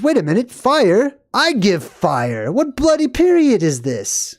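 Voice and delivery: high, incredulous mock outrage; indignant disbelief. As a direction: act out a God baffled and affronted. God indignant at humans having fire.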